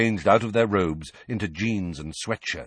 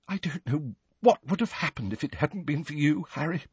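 higher, muffled words spoken into a hug; 8 semitones higher than usual. A narrator's reading. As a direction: say it muffled against his shoulder. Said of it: "she said in a muffled voice".

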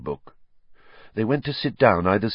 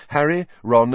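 ordinary delivery, nothing marked

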